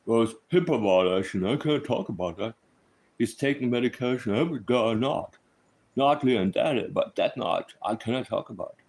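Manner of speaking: lightly voiced impression of an evasive official; a mild take on a stiff spokesman dodging questions; a hint of a guarded official brushing off the question; Chris Proudfoot voice. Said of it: "that's my Chris Proudfoot voice".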